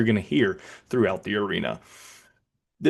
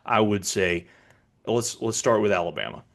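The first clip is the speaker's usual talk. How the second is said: ordinary talk, nothing marked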